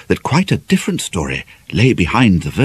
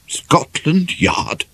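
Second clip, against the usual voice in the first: slow, husky, holding steady; husky but even; 8 semitones higher, much slower than usual. Acted. Bickley repeating Ivy's news, husky but even. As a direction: force the words out evenly through a tight throat. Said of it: "huskily but fairly evenly".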